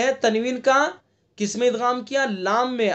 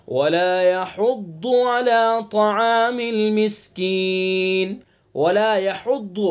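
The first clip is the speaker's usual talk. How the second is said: slow, chanted recitation of the verse; Quran recitation; slower than usual. Acted. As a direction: recite the verse slowly and melodically.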